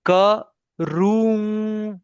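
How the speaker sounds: karu split slowly, second syllable held high into the nose; nasal ending demo. Shown as a demonstration. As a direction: model karu drawn out and sustained. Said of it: "look at on my face। Okay, I say".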